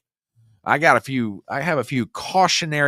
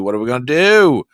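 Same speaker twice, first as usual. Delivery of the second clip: same question burst out much louder; louder exclaim; 2 semitones higher, 8 dB louder than usual. Acted. Host hamming it up to viewers, loud exclaim. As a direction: throw it out loud like a mock panic.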